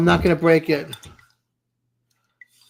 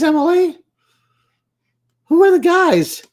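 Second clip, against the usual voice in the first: high-pitched, startled squeal of a question; shocked surprise; 12 semitones higher, 4 dB louder than usual. Real, not acted. Streamer reacting to chat, high startled disbelief. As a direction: gasp it out in disbelief. Misreads chat about kissing guys.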